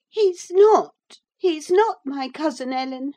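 put-on high, loud girlish wail; grieved denial. Girl protests to her housekeeper, high and grieved. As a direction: wail it, starting loud and easing. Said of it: "gathering fresh grief from reflection".

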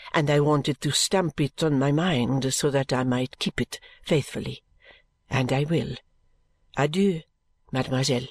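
low, measured French servant; mild French maid. Acted. French maid parts from mademoiselle with a curtsy, low and measured. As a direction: speak low and accented, politely taking leave. Narrator voices maid taking leave.

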